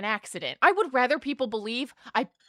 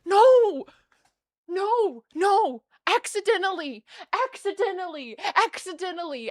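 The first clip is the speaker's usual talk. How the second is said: frantic denial; 8 semitones higher than usual. Retold or imitated. Patient insisting to staff it was accidental, fading. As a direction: plead it was an accident, trailing off. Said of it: "I'm like".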